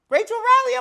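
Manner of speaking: high, loud gasp of surprise; shocked reveal. Real, not acted. Vlogger reacting to Rachel's return, shocked. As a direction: blurt the name like you can't believe it.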